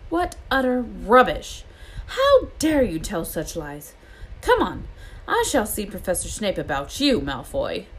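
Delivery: crush his excuse with outrage; stern scolding. Acted. McGonagall scolding Malfoy.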